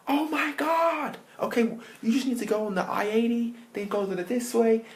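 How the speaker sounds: high, excited helpful local; gushing squeaky American caricature; friendly American biker. Acted. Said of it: "they started being really nice".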